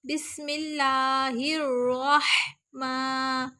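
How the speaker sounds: slow بسم اللہ الرحمن, stretching ما; long mim in Rahman. Shown as a demonstration. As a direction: lengthen the م in الرحمن. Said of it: "میم کھڑی زبر ما". (الرحمن میں میم کو لمبا)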